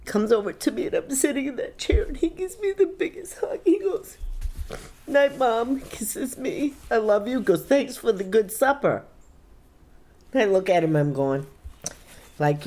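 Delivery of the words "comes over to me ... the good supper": high, tearful voice close to crying; choked up at a tender memory; real tearful grief. Real, not acted. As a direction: tell it through tears. Mother recalls son's last goodnight.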